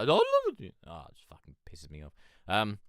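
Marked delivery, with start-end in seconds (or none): 0.0-0.7 voice cracking. Retold or imitated. Showing a teen how a voice break sounds, cracking high. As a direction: voice breaks high and loud mid-sentence. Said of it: "voice breaks".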